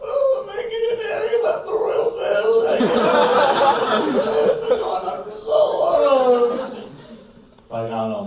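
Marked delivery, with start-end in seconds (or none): 0.0-6.6 very high, loud comic wailing; hammed-up sobbing wail; mock crying. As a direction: bawl it out in a squealing falsetto. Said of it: "like, crying".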